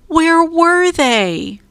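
high, slow, drawn-out worry; frantic searching. A narrator's reading. Jack gropes in sand for glasses, high anxious wail. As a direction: voice his rising panic.

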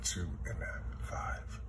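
counting under his breath in a whisper; whispering numbers while working them out. Real, not acted. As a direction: whisper the numbers to yourself, slowing.

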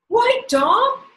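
high, loud cry of surprise; storyteller's astonished boy; great surprise. A narrator's reading. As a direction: exclaim it in astonishment. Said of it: "he cried in great surprise".

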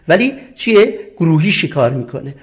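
usual speaking voice

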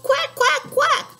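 high loud quacking; duck call. Acted. Papa Duck calling his ducklings, high quacks. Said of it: "Papa Duck called".

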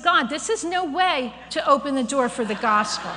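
loud, laughing pushback at God; laughing protest. Retold or imitated.

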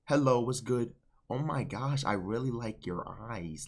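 imitating his friend, soft and low-energy; mock timid greeting. Coach mimicking how friend approaches girls, mock timid. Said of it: "Don't come in this bitch be like".

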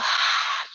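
long breathy exhale sigh; release sigh. Shown as a demonstration. Teacher sighing out breath for kids. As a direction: let it all out in a sigh. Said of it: "Let it go".

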